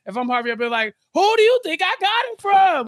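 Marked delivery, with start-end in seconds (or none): 1.1-2.9 high, loud mock outrage; indignant comeback. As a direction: fire back loudly in a high, exasperated voice. Acts indignant comeback as Harvey.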